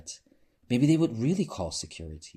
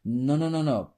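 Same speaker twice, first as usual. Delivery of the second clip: emphatic no; 6 semitones higher, 5 dB louder than usual. Acted. Peaches rejecting suit emphatically.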